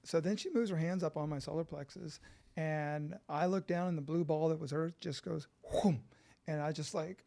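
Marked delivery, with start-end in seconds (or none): 5.8-6.0 short rushing whoosh effect; breath-filled sound effect; imitated whoom. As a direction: mimic something huge shooting away. Imitates Earth rushing away.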